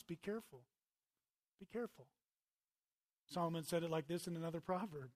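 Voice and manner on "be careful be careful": softer, earnest caution; soft warning. Real, not acted. Preacher softly warning the congregation to be careful. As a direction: lean in and warn gently.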